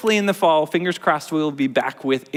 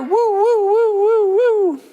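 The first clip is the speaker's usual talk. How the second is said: very high, louder siren noise; siren woo; 4 dB louder, slower than usual. Acted. Teacher imitates the siren for preschoolers, very high woos. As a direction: wail like a siren.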